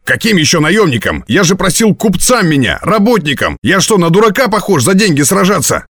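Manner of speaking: high, agitated questioning; indignant protest. A narrator's reading. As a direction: protest in outrage, pitch climbing. Man protests about the job.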